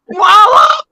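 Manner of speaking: high, loud blurted cry; mock exclamation. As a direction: blurt it out high, retelling their reaction. (seruan kaget tiruan, tinggi dan keras)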